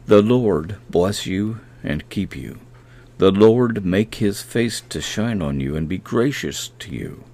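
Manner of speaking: a measured, reverent reading of the verse; scripture reading. Acted. Preacher reading Hebrews to podcast listeners, reverent. Reads a blessing.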